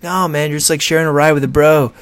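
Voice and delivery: lightly voiced chill dude; laid-back bro. Lyft pitching itself as a ride with a bro, easygoing voice. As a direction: say it like a mellow bro. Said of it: "Lyft's differentiator was like".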